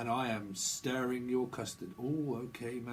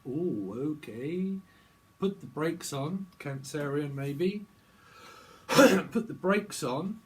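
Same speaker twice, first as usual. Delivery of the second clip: slow channeling drawl; 4 dB louder, much slower than usual. Acted. Slowed channeling voice.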